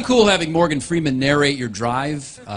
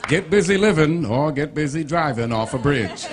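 Morgan Freeman; 4 semitones higher than usual. Acted. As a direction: narrate the directions like a documentary. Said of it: "it'll say things like".

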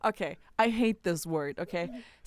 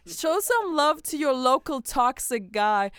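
mock rallying cry; 5 semitones higher, 8 dB louder than usual. Podcast host urging listeners to love toxic men, mock rallying cry. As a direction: call it out like a playful campaign slogan. Jokey public appeal to listeners.

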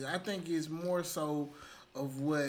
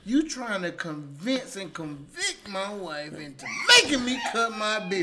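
raised-voice outrage at being pressured; indignant protest; 4 semitones higher, 7 dB louder than usual. Real, not acted. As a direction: protest loudly, slowing to hammer the words. Heated mock argument about beard.

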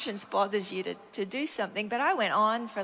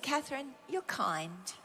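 gentle reassurance; 2 semitones higher, 7 dB quieter than usual. Retold or imitated. God calmly answers the anxious speaker, gentle reassurance. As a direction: say it quietly and simply, to soothe.

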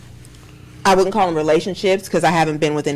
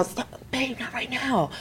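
hushed, higher-pitched acted plea; discreet protest; 6 semitones higher, 12 dB quieter than usual. Woman to her touchy partner in public, discreet protest. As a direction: act out quietly fending off a partner.

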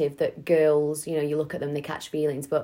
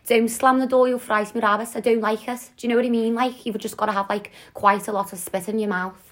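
higher-pitched Scouse accent, thick with spit; Liverpudlian accent; 4 semitones higher than usual. Shown as a demonstration. Co-host showing off her accents to a friend, Liverpudlian accent. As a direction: put on a Scouse impression, pitched up. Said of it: "I could do my Liverpudlian".

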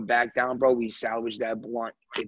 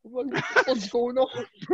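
panicked friend; 12 semitones higher, faster than usual. Retold or imitated. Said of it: "He's like".

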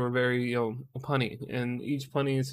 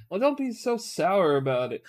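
high, teasing pun delivery; imitated wife; 6 semitones higher than usual. Wife teasing husband with a pun, high imitation. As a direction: tease in a raised girly voice.

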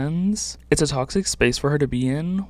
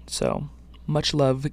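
ordinary talk

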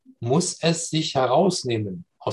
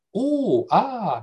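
high drawn-out oh and ah of wonder; astonished vowels; 8 semitones higher than usual. Shown as a demonstration. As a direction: sound out pure amazement. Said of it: "das ist sozusagen der Ausdruck des Erstaunens". (staunendes hohes Oh und Ah)

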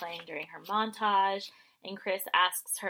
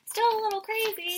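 higher, put-on mocking voice; lightly put-on higher voice; mock inner voice; 13 semitones higher than usual. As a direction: say it as a grudging fan, high and put-on. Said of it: "And you're like".

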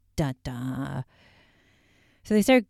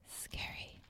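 a hushed whisper for effect; whispered scary; 21 dB quieter than usual. Real, not acted. Whispering to viewers about the ghost, hushed. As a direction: breathe it out like a campfire tale. Comments on spooky story.

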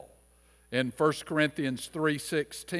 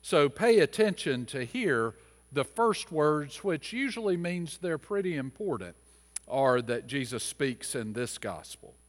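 ordinary talk, nothing marked

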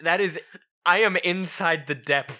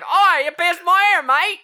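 high, loud mock Aussie cry; hammed-up Australian outrage; Australian accent; 9 dB louder than usual. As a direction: complain in an over-the-top Australian accent. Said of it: "she's like".

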